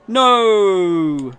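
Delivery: loud, high anguished no; despairing shout at a dead laptop; cry of despair. Acted. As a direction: wail as the laptop dies. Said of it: "Minokawa's cry of despair".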